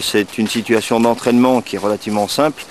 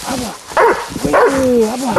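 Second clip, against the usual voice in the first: calling a dog; 12 semitones higher than usual. Real, not acted.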